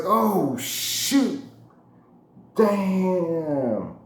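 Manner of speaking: sudden high dismay, breath on shoot; panicked realization the drink fell. Retold or imitated. Realizing his drink fell off the car, dismayed.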